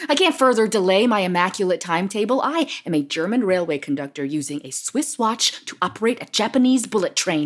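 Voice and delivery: brisk fast boast; rapid-fire. Acted. Woman defending her schedule to therapist, fast boast. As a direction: rattle off the timetable fast.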